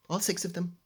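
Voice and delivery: usual speaking voice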